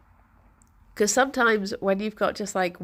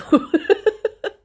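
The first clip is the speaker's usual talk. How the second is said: a high, loud burst of laughter; high squeaky laugh; 11 semitones higher, 8 dB louder than usual. Real, not acted. Streamer laughing at her own croaky voice, high squeal.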